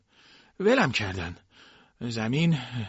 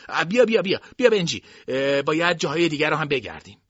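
urging call; 6 semitones higher, 8 dB louder than usual. A narrator's reading. Luster urging Benjy along.

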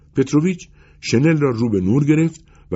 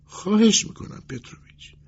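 high-pitched imploring voice; beseeching customer; pleading; 7 semitones higher than usual. A narrator's reading. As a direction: beg the tailor desperately, voice high. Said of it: "ملتمسانه گفت".

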